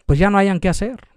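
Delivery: usual speaking voice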